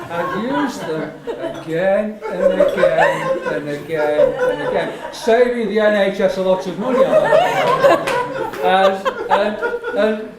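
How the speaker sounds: slow, loud words broken by laughter; laughing. Real, not acted. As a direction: chuckle your way through the joke. Laughing through own joke.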